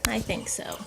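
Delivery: soft whispered answer; whispered aside. Real, not acted. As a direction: whisper it to yourself. Aside while filming birds.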